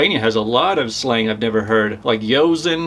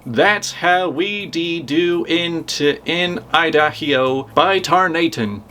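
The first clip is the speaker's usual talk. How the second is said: slow put-on Idaho country drawl; hammed-up backwoods drawl, slow; 2 semitones higher, slower than usual. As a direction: draw it out like an old-timer saying by tarnation. Reading Idaho sign in character.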